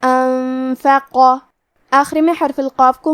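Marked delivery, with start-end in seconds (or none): 0.0-1.2 انفق with the ن hidden in a long nasal hum; very slow model reading of انفق, heavy ق; tajweed demo. Shown as a demonstration. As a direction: stretch انفق very slowly, nasal ghunna before ف. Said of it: "نون ساکن کو چھپاتے ہوئے اور غنہ کے ساتھ".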